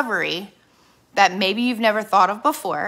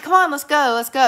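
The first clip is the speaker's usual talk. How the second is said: higher, louder impatient nagging; retold impatient nudge to leave; 5 semitones higher, 5 dB louder than usual. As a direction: hurry him along, fed up. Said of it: "And I'm like".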